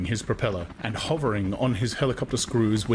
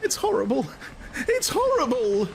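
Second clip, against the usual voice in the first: high, distressed repeated cry; a woman crying at the empty sea; tearful woman. A narrator's reading. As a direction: repeat it near tears. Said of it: "But Lenina was crying".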